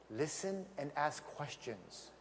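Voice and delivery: slow hushed advice. Shown as a demonstration. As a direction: lean in and say it softly and slowly. Hushed advice after shushing.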